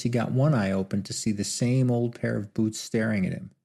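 usual speaking voice

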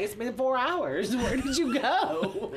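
slow, high, laughing mock complaint; baffled partner. Partner asking where she disappeared for hours, mock bafflement. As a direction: imitate exasperation, stretching the words out.

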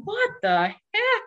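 high squeaky what-the-heck; startled disbelief. Retold or imitated. Noticing his feet off the ground, high startled disbelief. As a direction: caught off guard. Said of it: "And I thought".

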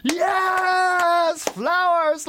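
loud, high cheering shout; hammed-up bravo yell; mock ovation cheer. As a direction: cheer like a theatre crowd at curtain call. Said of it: "At the end".

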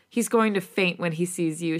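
usual speaking voice

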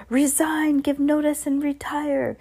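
high, eager echo; high-pitched chorus of followers; crowd echo. Acted. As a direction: repeat the order high, item by item. Witches echoing their leader's order.

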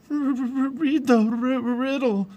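high, stuttering shivers; teeth chattering. A narrator's reading. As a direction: stammer each R through chattering teeth. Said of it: "her teeth chattering".

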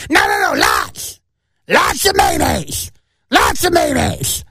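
high, slow, strained shout of a demanding woman; shrill shrieking customer yelling for mayo; screaming lady. Retold or imitated.

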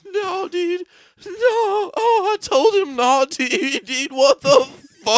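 high crying with laughs breaking through; hammed-up grieving friend; mock sobbing. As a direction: wail it, laughing through fake tears. Acted grief in mock ad.

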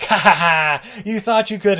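high, loud taunt after a fake laugh; hammed-up villain gloat; gloating laugh, mocking. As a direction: crow it at a failed attacker. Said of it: "Willis is like".

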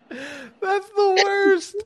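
mock wail. Mock dismay at funeral crashing.

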